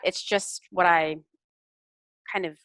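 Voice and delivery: ordinary delivery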